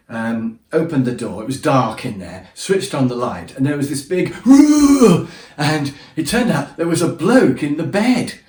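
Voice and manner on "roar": loud high mock roar from a woken stranger; startled roar. To the intruding guest, a woken stranger's mock roar. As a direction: bellow like a startled bear. Bloke rears up roaring in bed.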